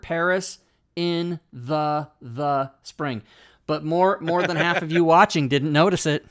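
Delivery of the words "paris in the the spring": slow, each word separated; reading word by word. Shown as a demonstration. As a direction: read it out one word at a time. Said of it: "read it word by word".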